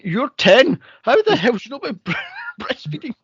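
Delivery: mock outrage. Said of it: "I'm going".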